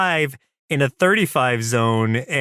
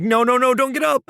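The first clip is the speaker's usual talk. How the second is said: much higher, rushed plea; urgent mimicked protest; 10 semitones higher, faster than usual. Host voicing Rayman stopping Grub from rising, urgent protest. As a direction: imitate a friend trying to stop him.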